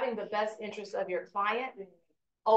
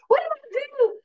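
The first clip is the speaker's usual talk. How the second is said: high, louder indignant squeak; amped-up protest; 5 dB louder than usual. Retold or imitated. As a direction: act all amped up and baffled. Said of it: "She's all amped up".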